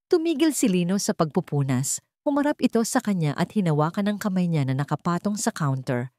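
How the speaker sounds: ordinary delivery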